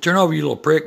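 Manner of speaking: loud, high angry yell; shouted insult. Retold or imitated. Biker yelling at a rude teen driver, loud and high. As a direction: yell it at the kid's car. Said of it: "I said".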